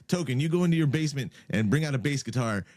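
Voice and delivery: quick, lightly voiced bossy kid; imitating Cartman. Quoting a cartoon episode to friends, quick bossy kid. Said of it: "Cartman's like".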